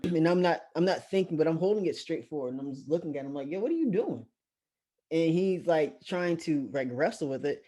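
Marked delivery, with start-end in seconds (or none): 3.5-4.2 baffled challenge. Retold or imitated. Questions man holding gun.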